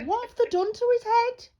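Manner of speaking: high startled gasp of a question; suddenly alarmed, voice jumping up; shocked. Retold or imitated. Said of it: "and I was like".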